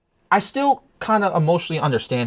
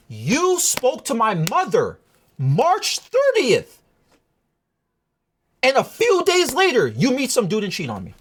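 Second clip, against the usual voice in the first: indignant accusation; 8 semitones higher, slower than usual. Retold or imitated. Confronting her over timeline.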